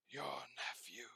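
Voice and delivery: hushed, breathy spirit voice; the Ghost of Christmas Past whispering to Scrooge; ghostly whisper. Acted.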